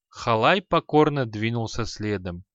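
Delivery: ordinary delivery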